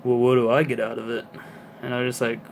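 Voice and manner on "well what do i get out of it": imitating actor. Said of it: "his response was".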